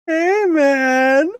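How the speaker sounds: put-on high, loud slurred drunk greeting; drunk friend. Drunk friend voiced in story.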